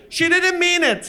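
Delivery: high, slow placating shout; mock pleading in a high voice; apologetic call. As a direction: call out an anxious excuse. Dad apologizes to soldiers.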